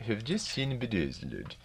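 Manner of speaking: put-on sing-song Swedish accent, falling end; Ingmar Bergman Swedish accent. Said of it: "This is Ingmar Bergman speaking".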